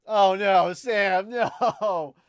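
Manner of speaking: loud laughing no, cracking up mid-word; loud laughing protest. Real, not acted. Loud laughing protest at a chat message. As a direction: burst out laughing while protesting.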